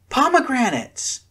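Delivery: high, loud cry of delight. A narrator's reading. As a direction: burst out in joy. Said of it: "Chihiro gasped in delight".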